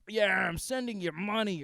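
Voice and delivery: imitated dad, lightly put on; breezy dad. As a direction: toss off an empty promise. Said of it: "he's like".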